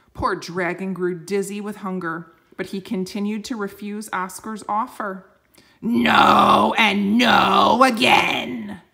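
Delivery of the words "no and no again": very slow, very loud shouted roar. Acted. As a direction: drag out each no as a huge roar. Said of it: "he roared".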